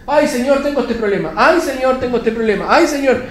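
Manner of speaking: high, loud mock pleading; complaining petitioner. Believer bringing God the same problem, mock lament. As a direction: whine to God in repeated high pleas. Mimics a person nagging God.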